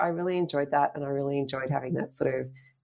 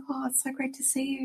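whispered, higher, delighted greeting; excited whisper; 11 semitones higher, 4 dB quieter than usual. Retold or imitated. To the returning lady, a delighted higher whisper. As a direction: breathe it out in a thrilled whisper.

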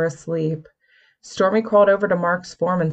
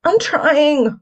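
strained, higher, louder grunt; 10 semitones higher, 5 dB louder than usual. A narrator's reading. Willow straining to close the circle, grunting. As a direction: force it out through effort. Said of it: "Willow grunted".

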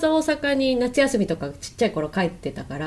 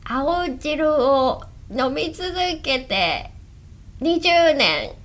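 slow granny impression slipping into gal talk; green-juice granny attempt; 4 semitones higher, much slower than usual. Host trying the commercial granny after the guest, gal-like failed impression. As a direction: badly fake an old lady's testimonial. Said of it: "え、私もやってみようかな".

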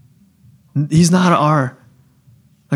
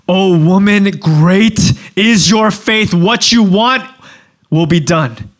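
raised, drawn-out proclamation; exclaimed praise; 3 semitones higher, slower than usual. Acted. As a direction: proclaim it in wonder, words stretched out. Acting Jesus exclaiming over woman's faith.

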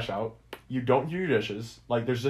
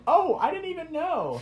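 much higher, airy mock-innocent voice; mock drunk excuse. Roommate mocking the drunk intruders' excuse, high mock-innocent voice. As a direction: squeak it out like a clueless drunk.

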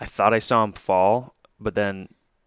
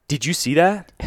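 higher and louder, keyed up; excited call; 7 semitones higher, 4 dB louder than usual. Retold or imitated. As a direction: blurt it out, amazed. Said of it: "and I'm like".

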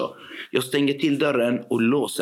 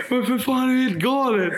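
very high-pitched, outraged retold yell; mock shout; 11 semitones higher than usual. Assistant yelling at teachers after scissors attack, mock shout. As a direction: re-enact a furious outburst without full volume. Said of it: "jag skriker till de lärarna".

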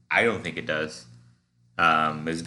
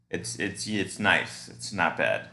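normal talking voice

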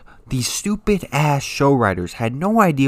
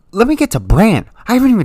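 louder and higher, worked up; angry outburst; 8 semitones higher, 8 dB louder, faster than usual. Real, not acted. Ranting about Bran, loud and worked up. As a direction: launch into the next grievance at full volume.

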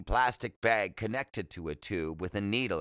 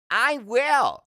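put-on very high, loud kid's reply; young boy's voice; 8 dB louder than usual. A narrator's reading. Boy promising the patient to pass on hello, high loud kid voice.

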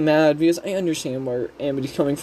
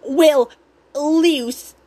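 mock snooty mom calling out, pitched up; Odalia; 8 semitones higher than usual. Odalia addresses Luce, attempted Karen voice. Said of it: "that's not a Karen voice".

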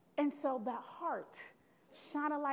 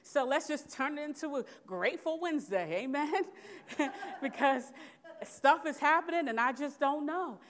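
loud, laughing call to the room; laughing amen, louder at the end; laughing preacher; 3 semitones higher, 5 dB louder than usual. Real, not acted. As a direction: laugh it off and rally them. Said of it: "laughing, loud".

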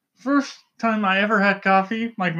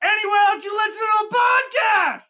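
monster yell; 13 semitones higher than usual. Acted. Host yelling the sign-off into the mic. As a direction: bellow it like Cookie Monster. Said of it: "Why are you yelling into the mic?".

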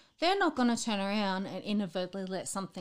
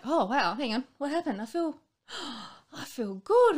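higher, louder delighted surprise with a gasp; mock amazed relief; 7 semitones higher, 7 dB louder than usual. Patient recovering to herself, amazed and louder. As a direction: act out sudden recovery. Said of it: "you're sort of like".

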